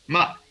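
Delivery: short ma falling from high; fourth tone. Shown as a demonstration. Teacher going through the tones, fully descending ma.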